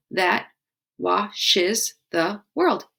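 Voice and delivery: That washes the world said very slowly, each syllable spaced; slow syllable count. Shown as a demonstration. Teacher counting syllables of the haiku line, slowly. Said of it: "Five syllables".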